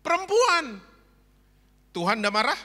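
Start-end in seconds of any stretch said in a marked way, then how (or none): 0.1-0.8 high, loud accusing cry; blaming. Acted. Adam blaming the woman before God, high and loud. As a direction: shift the blame in a yelp.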